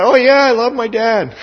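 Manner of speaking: high-pitched mock-eager kid voice; gushing teenager. Teenager asked whether he loves his dad, mock gushing. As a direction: protest it high and too eagerly.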